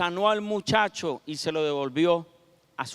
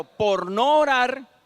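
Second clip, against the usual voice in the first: "por no orar" higher, louder and very slow; preaching emphasis; 4 semitones higher, 6 dB louder, much slower than usual. Real, not acted. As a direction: press each word home to the congregation. Predicador enfatiza punto clave. ("por no orar" dicho alto, fuerte y muy lento)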